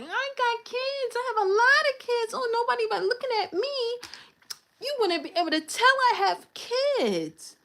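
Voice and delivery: high, sing-song mock of the mother bragging. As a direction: mimic her carefree brag in a high voice. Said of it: "So now she's able to just like".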